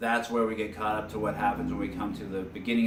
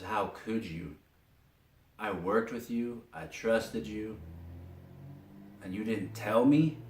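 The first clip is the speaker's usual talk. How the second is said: pained; 5 dB quieter than usual. Retold or imitated. Commissioner to Batman who betrayed his trust, mildly pained. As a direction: quietly imitate wounded disbelief, voice lifting at the end. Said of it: "you could feel the pain".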